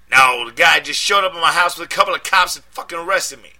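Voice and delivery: high, loud street voice in a panic; panicked friend. A narrator's reading. Deshaun panicking down the phone at his friend, loud and high. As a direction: yell it like you're scared and angry.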